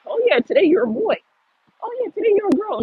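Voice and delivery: mock the message with a sneer; mocking quote. Mocking what society tells children, sarcastic high voice.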